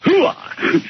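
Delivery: high, shouted army hoo-ah. Acted. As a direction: cheer it like a grunt. Caller's greeting chant.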